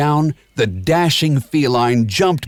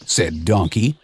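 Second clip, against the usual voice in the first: plain reading voice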